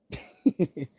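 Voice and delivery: high burst of laughter; cracking up at his own crude joke; loud laugh. Real, not acted. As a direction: let a big laugh out.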